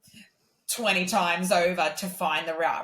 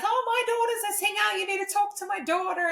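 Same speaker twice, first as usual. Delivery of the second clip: imitating a gushing mum, much higher; high, eager mum bragging about her daughter; mock-proud mother pushing her daughter; faster than usual. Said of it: "as your mum does".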